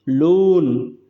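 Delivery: "लोन" isolated and held long; stressed syllable. Shown as a demonstration. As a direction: lean into "लोन" with a long vowel. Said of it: "stressed".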